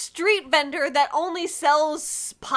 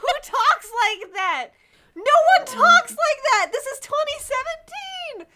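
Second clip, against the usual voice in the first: high-pitched indignant outburst, getting louder on key words; real exasperated rant, pitched way up; outraged disbelief; 7 semitones higher, 5 dB louder than usual. Real, not acted. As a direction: exclaim it in scandalized disbelief. Outraged at the book's dated slang.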